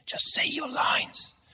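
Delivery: put-on hissed stage whisper to a frozen child; teacher prompting a stuck Joseph in a whisper; urgent whisper. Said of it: "the teacher was, was saying".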